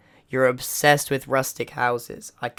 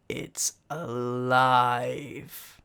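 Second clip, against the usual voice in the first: mad scientist; much slower than usual. Acted. Mad inventor wakes his robot.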